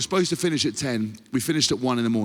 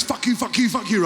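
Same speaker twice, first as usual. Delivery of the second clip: shouting; 8 semitones higher than usual. Retold or imitated.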